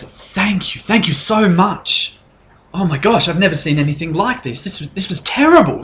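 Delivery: high, gushing half-elf barmaid; grateful barmaid. Acted. Half-elf barmaid thanking her rescuers, high gushing voice. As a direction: gush your thanks in a lifted voice. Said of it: "She walks over to you and goes".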